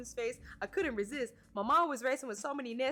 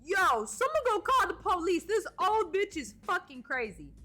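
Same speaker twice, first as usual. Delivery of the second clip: yelling; 8 semitones higher, 6 dB louder than usual. Acted. Manager yelling at the scene.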